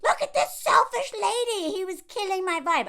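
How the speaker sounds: loud, high mock outrage; hammed-up indignant shriek; outraged tabloid. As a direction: squawk it like a scandalised headline. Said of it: "one of these things that are like".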